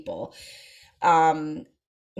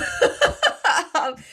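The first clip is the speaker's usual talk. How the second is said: high, loud burst of laughter; real laugh; 11 semitones higher, 6 dB louder than usual. Real, not acted. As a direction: break into a high-pitched laugh. Laughs after the touching punchline.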